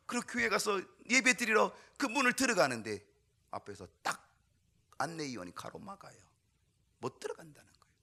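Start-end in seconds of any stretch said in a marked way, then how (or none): 3.5-7.9 "못 들어간다는 거예요" whispered, soft and breathy; hushed retelling. Retold or imitated. Pastor telling how the boy was refused, whispered. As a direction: let it drop to a hush. Retelling the child turned away.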